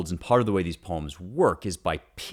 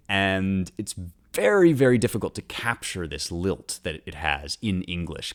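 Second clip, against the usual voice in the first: ordinary delivery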